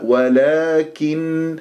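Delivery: ولكن slow, kaf with a clean i vowel; clear kasra on kaf. Shown as a demonstration. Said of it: "اكسر الكاف جيدا".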